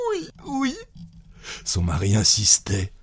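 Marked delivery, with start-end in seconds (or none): 0.1-0.7 tiny high, soft yes; choked stammer. A narrator's reading. Wife choking with laughter, stammering her answer. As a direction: squeak it out, stifled. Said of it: "elle balbutia, en étouffant".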